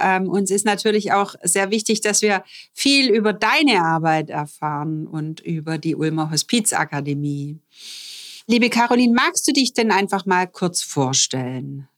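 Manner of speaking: usual speaking voice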